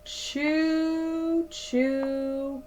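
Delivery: plain reading voice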